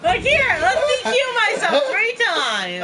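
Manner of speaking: high, loud and drawn out; mocking, laughing. Real, not acted. Teasing a player over disqualifying himself, laughing mockery. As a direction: hoot it through laughter, stretching it slowly.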